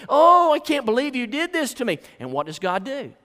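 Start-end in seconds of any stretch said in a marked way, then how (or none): 0.1-2.0 high, loud mock whine; hammed-up self-pity; whining complaint. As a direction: wail it as an injured grumbler. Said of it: "just whining and complaining".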